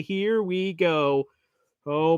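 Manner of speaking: usual speaking voice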